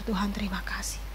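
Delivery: soft whispered prayer of thanks. Retold or imitated. Grateful believer whispering thanks to God, hushed whisper.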